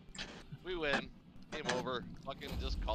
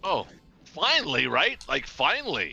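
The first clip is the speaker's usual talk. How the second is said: excited; 6 semitones higher, 11 dB louder, slower than usual. Real, not acted. Relief at long-awaited good roll.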